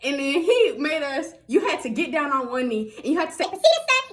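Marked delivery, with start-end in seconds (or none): 3.4-4.1 high-pitched mock recruit call; recruit's response. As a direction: sound off in an exaggerated high voice. Recruits' forced response, acted.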